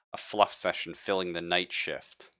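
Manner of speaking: normal talking voice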